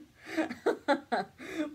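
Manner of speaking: bright rising laugh. Real, not acted. After joking to coworker, real rising laugh. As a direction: burst out laughing at the joke. Laughing at her own dark joke.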